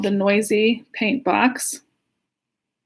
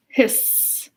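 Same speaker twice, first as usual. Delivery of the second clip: hiss. A narrator's reading.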